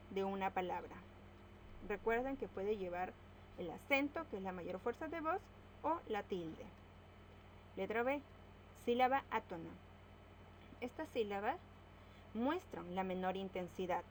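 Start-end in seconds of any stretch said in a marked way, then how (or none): none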